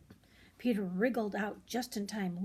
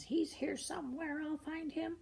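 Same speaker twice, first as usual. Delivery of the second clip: higher, determined muttered voice; searching farmer; 8 semitones higher, 3 dB quieter than usual. Acted. Mr McGregor searching the tool shed, higher. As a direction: hunt around the shed.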